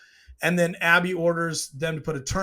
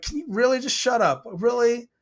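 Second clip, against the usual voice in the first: high-pitched exasperated plea; fed-up viewer talking back to the screen; retold exasperation; 6 semitones higher than usual. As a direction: complain at the TV, pitched up. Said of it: "what I said to the T V".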